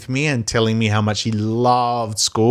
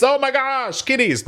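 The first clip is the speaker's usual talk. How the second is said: much higher, hammed-up teacher voice greeting the kids; big show voice; 3 dB quieter than usual. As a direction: play the over-the-top kindergarten entertainer. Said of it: "I was putting on a show with a great big voice".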